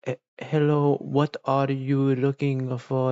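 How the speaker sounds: slow, careful beginner English to a foreigner; halting shop clerk English. Retold or imitated. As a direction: spell it out like a nervous clerk. Clerks address him in English.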